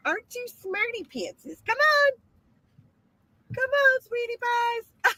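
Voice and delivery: high, sing-song cooing to animals. Real, not acted. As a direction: coo at them like babies. Cooing at wet donkeys.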